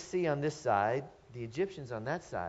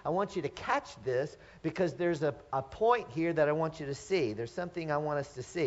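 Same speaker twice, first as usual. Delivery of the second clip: usual speaking voice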